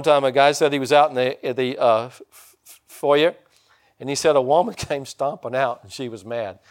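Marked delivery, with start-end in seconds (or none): none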